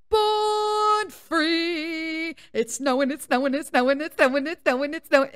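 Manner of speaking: sung-out high opening, then excited chanting; high, giddy snow chant; gleeful dog voice. Acted. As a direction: voice a dog running wild with joy. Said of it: "And Buster's running with his ears".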